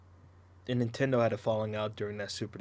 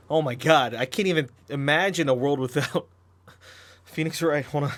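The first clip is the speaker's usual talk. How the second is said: breathless, loud, laughing through words; gasping after a laughing fit; out of breath from laughing; 4 semitones higher, 7 dB louder than usual. Real, not acted. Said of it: "Gotta catch my breath".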